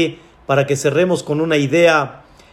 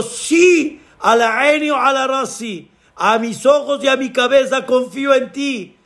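high, fervent cry of trust; fervent pleading; 6 semitones higher than usual. Acted. Climber swears trust to God, fervent high cry. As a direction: swear it desperately, pitched high.

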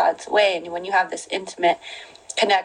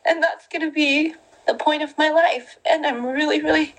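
higher voice, close to tears with joy; getting emotional; 8 semitones higher than usual. Real, not acted. Telling viewers about God's love, tearfully emotional. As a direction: let the emotion rise into the words. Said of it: "Sorry, I'm getting emotional".

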